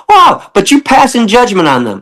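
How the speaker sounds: high, sarcastic wow; mock amazement. Telling the pig skeptics, mock astonished. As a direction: feign surprise at their judging. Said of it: "I go".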